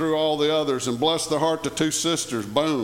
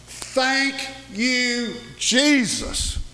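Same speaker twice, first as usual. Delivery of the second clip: praising Jesus; 6 semitones higher, much slower than usual. Real, not acted. Praise exclamation mid-sermon.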